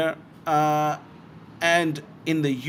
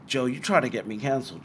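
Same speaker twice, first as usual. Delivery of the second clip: usual speaking voice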